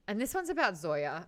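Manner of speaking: normal talking voice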